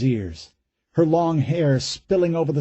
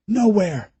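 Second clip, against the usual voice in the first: panicked thought; 8 semitones higher than usual. A narrator's reading.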